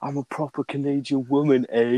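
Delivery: a lightly put-on Canadian accent, tagging eh; half-hearted Canadian woman accent; bad Canadian impression. Mocking a Canadian woman shouting at a guy, light Canadian accent. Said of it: "I'm gonna do a bad impression here".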